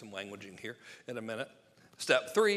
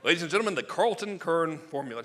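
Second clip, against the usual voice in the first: loud, raised announcer's introduction; mock announcer; 5 semitones higher, 10 dB louder than usual. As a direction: announce it grandly to the crowd. Mock grand introduction of the formula.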